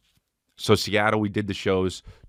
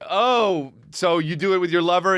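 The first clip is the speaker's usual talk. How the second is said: mock question; 11 semitones higher than usual. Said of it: "you're like".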